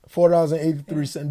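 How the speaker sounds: a loud, stunned repeat of the amount; emphatic. Real, not acted. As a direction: stress the disbelief in the number. Repeating how little money he had.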